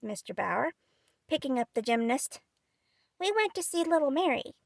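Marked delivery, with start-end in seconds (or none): 3.2-4.5 put-on high, simple small boy; lightly voiced little boy. Demi answers grandfather.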